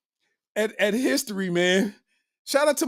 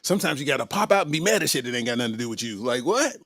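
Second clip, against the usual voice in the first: deep mock voice; 10 semitones lower, 3 dB quieter, faster than usual. Deep mocking voice repeat.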